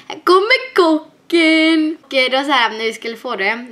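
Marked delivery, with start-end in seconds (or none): 0.1-2.0 slow, loud and higher, a dad hamming it up; dad calling out to the kids, proud and playful; cheery dad announcement. Retold or imitated. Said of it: "Varje gång han kom då så här".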